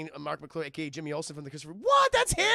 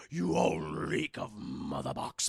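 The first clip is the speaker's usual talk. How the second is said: much lower, slow raspy growl; monster growl; much slower than usual. Retold or imitated. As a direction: snarl like a hulking alien brute. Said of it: "He's like".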